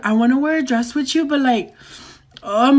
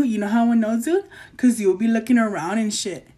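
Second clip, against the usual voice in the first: ordinary delivery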